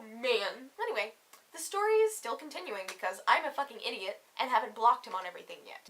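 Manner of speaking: normal talking voice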